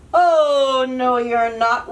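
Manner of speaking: loud troll roar. Acted. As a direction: roar it out at the goat. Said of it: "roared the troll".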